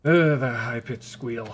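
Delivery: loud, drawn-out groan of complaint; real disgust. Real, not acted. Gamer recoiling from a horrible audio clip, disgusted. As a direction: groan it out as if the sound hurt your ears.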